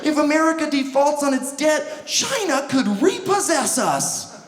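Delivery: high-pitched fretful voice; mock worry. Worrier fearing China could repossess us, mock anxiety. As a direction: fret in a high, mock-anxious voice. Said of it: "That's always the big worry".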